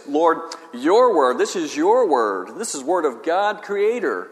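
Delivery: slow, leaning on each word; slow reverent stress on your and word; meditative emphasis. Shown as a demonstration. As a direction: savour every word as a prayer. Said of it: "You emphasize every word".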